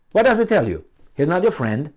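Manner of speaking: usual speaking voice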